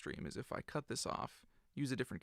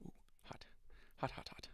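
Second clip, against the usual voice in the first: whispering; 8 dB quieter than usual. Real, not acted. Touching hot solder.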